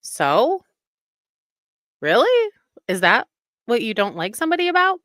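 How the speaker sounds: scoffing, disbelieving question with a big pitch jump on Really; mock the husband's incredulity, fast and loud; imitating an unimpressed husband; incredulous husband. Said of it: "My husband will say".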